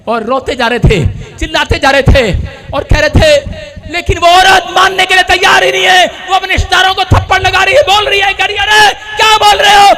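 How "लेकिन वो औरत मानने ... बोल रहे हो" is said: high, insistent revival cry; impassioned preaching. Acted. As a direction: act out defiant faith at a preacher's pitch. Preaches woman's defiant faith. (ऊँची आवाज़ में ज़ोरदार प्रचार)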